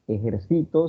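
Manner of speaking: "ejercito" stressed on CI; "ejercito" read as written, punch on the next-to-last syllable; stress on penultimate syllable. Shown as a demonstration. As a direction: say "ejercito" as e-jer-CI-to. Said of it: "tenían que pronunciarse, eh, tal cual".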